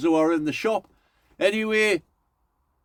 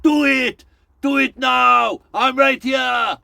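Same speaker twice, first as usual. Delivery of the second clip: slow, loud, high Austrian action-hero shouting; hammed-up Arnold Schwarzenegger battle cry; Arnie impression; 7 semitones higher, 6 dB louder, slower than usual. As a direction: yell each command slowly like Arnie. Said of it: "doing an impression of Arnie".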